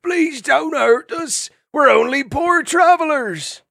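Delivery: high, slow, loud hammed-up pleading; piteous cry. Disguised travelers pretending fear of vermin, shrill fake pleading. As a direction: beg like a helpless old creature, overplayed. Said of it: "crying piteously".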